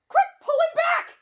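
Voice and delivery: high, drawn-out frantic cry; urgent alarm. Acted. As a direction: call for help urgently. Friend going into fog.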